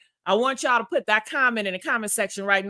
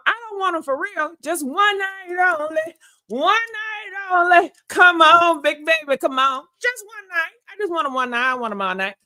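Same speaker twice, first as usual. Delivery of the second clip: playful lust; 6 semitones higher, 3 dB louder than usual. Pretend. Joking to viewers about Ralph, high and playful. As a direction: beg for just one night, laughing at herself.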